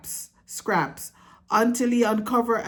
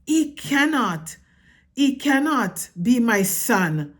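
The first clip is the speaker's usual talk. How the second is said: higher, louder anguished protest; despairing father mouse; 5 semitones higher, 4 dB louder than usual. A narrator's reading. Father mouse protests to his wife in despair. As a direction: cry out in disbelief. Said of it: "Lester said".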